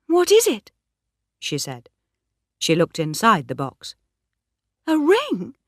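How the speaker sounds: Bathsheba giving way; reluctant yielding. A narrator's reading. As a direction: give in because there's no way out. Bathsheba refuses then accepts ring.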